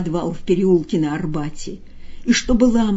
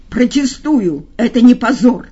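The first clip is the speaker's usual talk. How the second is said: loud, raised cat's yell; 4 semitones higher, 7 dB louder than usual. Acted. The cat yelling his protest at the master, loud. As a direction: bellow the protest. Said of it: "орал кот".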